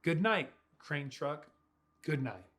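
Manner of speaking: ordinary delivery, nothing marked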